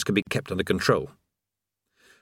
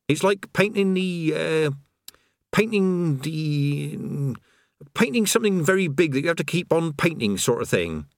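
put-on higher, breezy voice of a chatty old workman; chatty creator; 5 semitones higher than usual. Creator chatting idly beside a falling man. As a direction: natter on as if all is fine.

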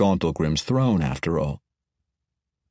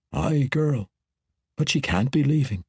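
raised dwarf voice, unlike the narrator's; dwarf refusing to let her go; dwarf king voice; 6 semitones higher than usual. A narrator's reading. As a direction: voice the dwarf lord, pained. Bruner speaks in dwarf voice.

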